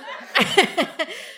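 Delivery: high real laugh; amused laugh. Real, not acted. Amused by co-host agreeing.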